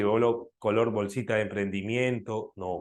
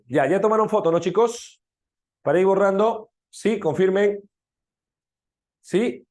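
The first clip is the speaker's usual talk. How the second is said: calling out; 8 semitones higher, 6 dB louder than usual. Real, not acted. Teacher calling class to confirm.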